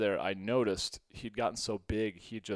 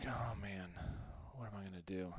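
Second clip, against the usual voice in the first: softer, deflated mutter to himself; quiet worry; 3 semitones lower, 11 dB quieter, faster than usual. Retold or imitated. Teen stuck with a loose snake at night, soft deflated worry. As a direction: sigh it out, out of options. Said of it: "So I'm like".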